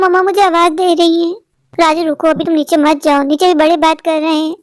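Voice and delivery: normal talking voice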